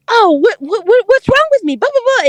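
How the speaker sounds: high, stammering mock whine; mocked psycho freak-out. Imitating the date she wants to break off with, freaking out. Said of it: "he just went all psycho on me".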